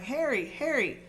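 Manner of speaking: high, calling out his name; distant calling. Acted. Voices call to the fainting Harry, high calling. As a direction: voices calling from far away. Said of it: "other voices ... crying".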